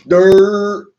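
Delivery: sudden loud, scornful bark; one loud scornful word; loud contempt. Real, not acted. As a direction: spit the word out with disgust.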